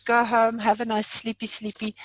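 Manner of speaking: ordinary talk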